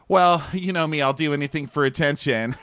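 high, playful put-on reply; mock breezy contestant. [name] answering about his sobriety necklace, mock breezy.

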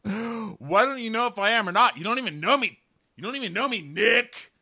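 high, loud boyfriend impression; mock outraged rant. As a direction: shout in hammed-up wounded fury. Voicing Josh yelling at Nick.

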